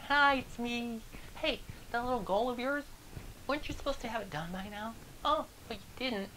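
high, put-on voice, sing-song gloating; mocking coworker voice. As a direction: play the smug coworker who came to gloat. Negative coworker gloating at the door.

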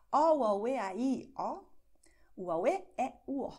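O, o, e, a, i spoken at natural Brazilian speed; vowels chained at normal speed, loud and bright; normal-speed vowel sentence. Shown as a demonstration. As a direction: run the vowel sentence at a native pace. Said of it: "Ich habe das normal".

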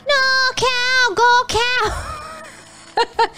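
very high, drawn-out cheering wail; stretched, squeaky plea; high-pitched urging. Real, not acted. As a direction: squeal the cow onward. Calling after the flying cow.

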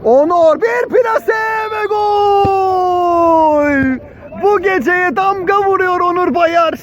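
high, drawn-out, shouted goal; screaming goal call. Real, not acted. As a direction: stretch out the goal like a TV commentator. Goal scored in commentary.

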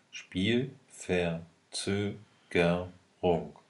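slow, syllable by syllable; sounding out words. Shown as a demonstration. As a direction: stretch Spielverzögerung into syllables. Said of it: "Silbe für Silbe lesen und schreiben kannst".

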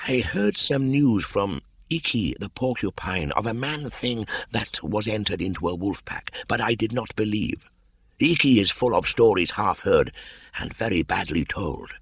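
high, slithery python voice; a wary old snake, curious; a lightly hissing old python; Kaa the python. Acted. Kaa's voice in reading.

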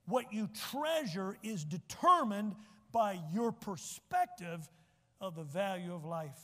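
slow, emphatic restatement; slow deliberate preacher emphasis. Shown as a demonstration. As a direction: drag it out so it sinks in. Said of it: "I'll say it again".

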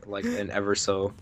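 ordinary delivery

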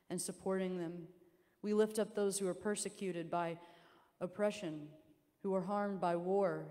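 measured pastoral prayer, each petition weighed; spoken prayer. Real, not acted. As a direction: lead the congregation in prayer.

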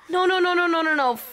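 rapid high string of alarmed refusals; real panic at the screen; panicked pleading. Real, not acted. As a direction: protest in a high, distressed voice. Distressed at the fight on screen.

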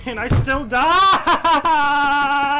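long drawn-out loud cry; exasperated wail. Real, not acted. Gamer protesting yet another death, exasperated wail. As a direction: wail the last word out.

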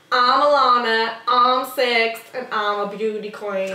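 put-on slow, loud opening settling down, child beauty queen; put-on little pageant contestant introducing herself; Honey Boo Boo. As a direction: imitate a six-year-old pageant girl, drawn out. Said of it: "Honey Boo Boo".

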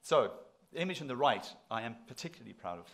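normal talking voice

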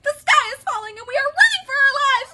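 very high, loud strained chick squeal; Chicken Little. A narrator's reading. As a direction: cry it in exhausted panic. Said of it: "croaked Chicken Little".

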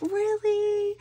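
high, eager questioning squeal; excited. Retold or imitated. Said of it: "I was all excited".

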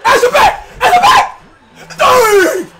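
very high rising yell; excited yell. Real, not acted. Gamer celebrating a bucket, very high excited yell. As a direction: shriek it with joy.

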